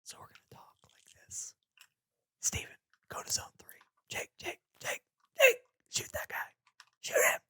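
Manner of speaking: breathy ASMR whisper. Shown as a demonstration. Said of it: "we have to go A S M R mode".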